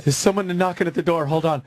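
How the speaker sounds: high-pitched imitation of a frightened woman; mock scared neighbor. Woman on the phone hearing knocks at her door, mock scared. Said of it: "I'm really scared".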